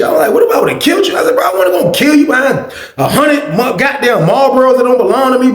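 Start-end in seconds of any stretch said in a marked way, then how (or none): 0.3-1.2 much higher, mock-indignant echo; incredulous. Narrator echoing his friend's complaint back, incredulous and high. 1.3-5.5 higher, raised voice of protest; heated protest. Retold or imitated. Narrator protesting to his friend, a raised heated voice.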